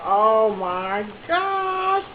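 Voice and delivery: drawn-out, word by word; awestruck reaction. Retold or imitated. Two friends looking at each other at the drive-through, awestruck. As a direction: stretch each word out very slowly.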